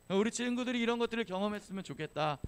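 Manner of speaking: usual speaking voice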